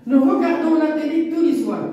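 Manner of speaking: teacher modelling a statement; declarative reading. Shown as a demonstration. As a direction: read it as a plain statement that falls. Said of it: "C'est une phrase déclarative".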